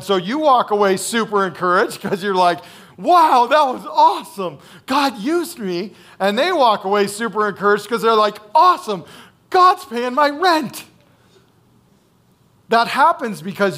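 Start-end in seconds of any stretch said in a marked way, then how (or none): 3.0-4.6 higher and louder, thrilled wow; giddy high exclamation; excited. Pretend. Same quote repeated with excitement. 4.9-5.9 slowed down, savoring it; awed, slow; slow amazement. Pretend. Continuing the excited quote. 8.5-10.8 high and louder, slowing on rent; relieved delight bursting out; grateful high exclamation; overjoyed. Pretend. Recipient's joyful imagined reaction.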